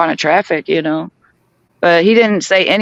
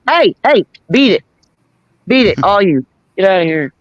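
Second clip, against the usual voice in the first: calling out sharply. Real, not acted. Speaker shoos someone off mid-story, calling out sharply. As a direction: call out to shoo them away.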